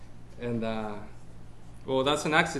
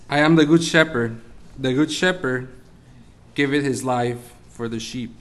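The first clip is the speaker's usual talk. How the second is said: scripture reading; 6 dB louder than usual. Acted. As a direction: proclaim the verse louder, solemn and measured. Reads scripture aloud.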